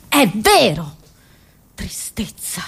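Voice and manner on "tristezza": hushed, bleak aside; desolate whisper. Acted. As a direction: drop to a bare whisper. Bleak aside after grim fact.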